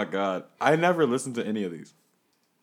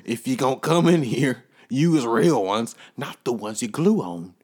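bouncer; 8 dB louder than usual. Acted. Bouncer skit, louder.

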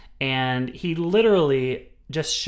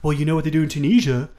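louder, quick, smug put-on voice; mock know-it-all; 4 semitones higher, 7 dB louder, faster than usual. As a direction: lecture like a smug college kid. Mocking know-it-all student.

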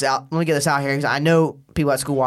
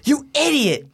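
high, furious mock rant; imitating mom yelling; 9 semitones higher than usual. Mom yelling at her son over speaker, high furious rant. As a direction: rail at him like an enraged mom.

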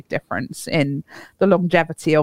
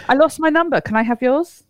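higher, playful chat-up delivery; cheesy pickup line; 8 semitones higher than usual. Pretend. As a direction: pitch it like a cheeky flirt.

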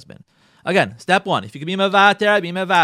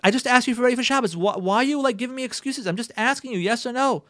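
higher, faster exasperated husband; frustrated husband; 8 semitones higher than usual. Retold or imitated. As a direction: sound fed up with the excuses. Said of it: "men get frustrated".

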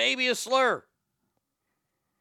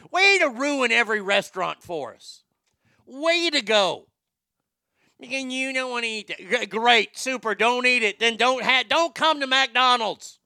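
sarcastic rant; 6 semitones higher, 6 dB louder than usual. Acted.

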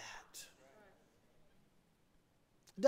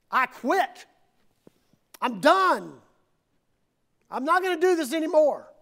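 fed-up quitting; 3 semitones higher than usual. Acted. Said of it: "Jeremiah's turning in his resignation".

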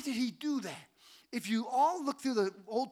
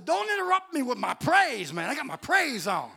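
loud, raised comic protest; mock indignant shout; 4 semitones higher, 8 dB louder than usual. Worshiper to girls laughing from the next car, mock indignant. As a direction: holler it in playful indignation.

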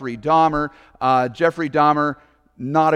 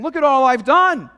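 higher, self-righteous bragging protest; indignant Peter; 7 semitones higher than usual. Retold or imitated. Peter boasting to Jesus, higher and indignant. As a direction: list your good deeds like an offended apostle, pitched up. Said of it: "someone with Peter's mindset saying".

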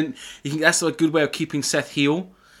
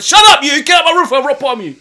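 much higher, louder playful yell; mock shout; 11 semitones higher, 9 dB louder than usual. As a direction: bellow it at mum like a wrestler.

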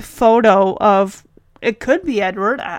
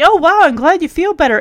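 mock cheerful Kate; 5 semitones higher, 5 dB louder, faster than usual. Recap, voicing Kate's fake cheer.

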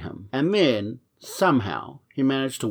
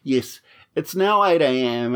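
ordinary talk

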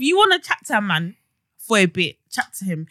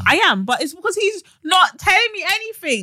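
half-shouted, higher protest; shouting; 8 semitones higher than usual. Real, not acted. Defending herself, shouting.

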